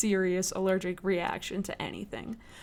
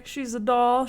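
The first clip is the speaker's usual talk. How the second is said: ordinary talk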